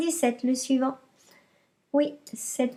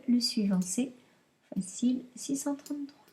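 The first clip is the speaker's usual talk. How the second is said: whisper; 3 semitones lower, 7 dB quieter than usual. Shown as a demonstration. Answer whispered to pupils.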